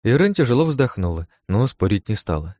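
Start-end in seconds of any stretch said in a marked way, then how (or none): none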